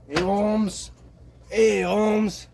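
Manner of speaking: mock Mexican tough guy calling Holmes, in a clumsy Mexican accent; bad Mexican accent. Imitating immigrants boasting of ruling Chihuahua, exaggerated Mexican accent. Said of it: "I can't do a Mexican accent".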